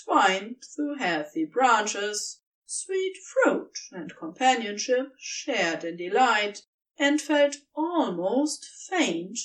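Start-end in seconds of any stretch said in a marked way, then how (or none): none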